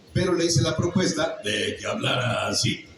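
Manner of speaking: ordinary talk, nothing marked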